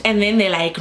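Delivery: normal talking voice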